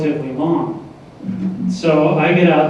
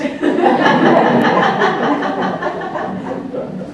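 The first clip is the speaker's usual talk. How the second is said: a long airy laugh at his own foolishness; real amused laugh. Real, not acted. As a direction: let a breathless chuckle roll out.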